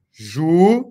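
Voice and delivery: "Juiz" as a loud rising ju, pause, then iz; syllable separation. Shown as a demonstration. Teacher showing students the syllable separation, "Juiz" broken in two. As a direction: split "Juiz" firmly into ju... iz. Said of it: "Essa é a separação silábica".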